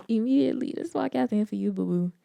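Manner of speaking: sassy dismissal. Real, not acted. Telling racists to leave.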